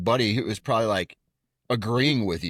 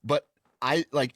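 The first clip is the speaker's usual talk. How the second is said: ordinary delivery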